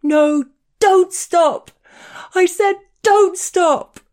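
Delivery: high, loud, slow wounded shriek; a confused, furious lover shrieking the order; angry shriek. Acted. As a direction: howl it like a wounded animal in rage. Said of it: "shrieked in a voice that resonated with anger".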